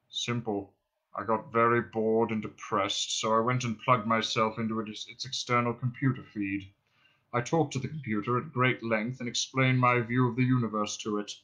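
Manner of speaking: low, self-pitying drone; depressed robot. Acted. Marvin accuses Ford of hating him, morose drone. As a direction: drone it wearily, without hope.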